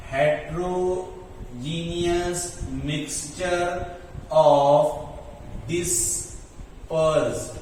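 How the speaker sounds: word by word, with long pauses; slow dictation. Real, not acted. Teacher saying it while writing it down, slow dictation. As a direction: dictate slowly at writing speed. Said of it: "मैं लिखता हूं इस बात को".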